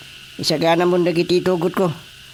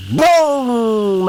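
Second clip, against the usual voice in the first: a short, loud imitated blast; a louder, higher "Bong!" for the blast; mock explosion; 6 semitones higher, 3 dB louder than usual. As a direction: boom it out like dynamite going off. Mimicking dynamite blast while fishing.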